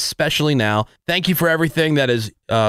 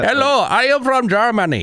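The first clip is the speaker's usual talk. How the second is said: high-pitched cartoon German accent; 9 semitones higher than usual. Host proving his German accent is spot on, German accent. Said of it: "German accent".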